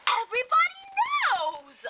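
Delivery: high exasperated cry; exasperated outburst. Acted. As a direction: fling it out high, fed up. Quarrel about the car.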